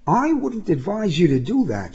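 high, evenly spoken warning. A narrator's reading. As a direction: warn him without raising the volume. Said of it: "she said evenly".

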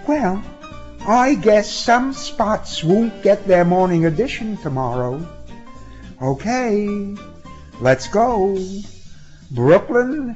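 high-pitched, resigned concession. A narrator's reading. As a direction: give in, in a high character voice.

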